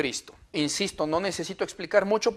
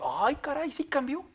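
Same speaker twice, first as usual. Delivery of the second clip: high, soft, impressed gasp; amazed reaction; 8 semitones higher, 10 dB quieter than usual. Retold or imitated. The friend marvelling at the monk, high and soft. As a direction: sound struck by the change.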